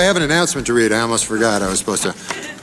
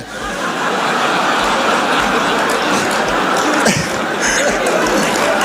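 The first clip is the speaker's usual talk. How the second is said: big room laugh; crowd laughter; 12 semitones higher than usual. Real, not acted.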